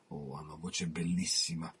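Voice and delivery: breathed out in a whisper, full of admiration; admiring whisper. Acted. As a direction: whisper it like a secret delight. Admiring aside on his voice. (sussurrato con ammirazione)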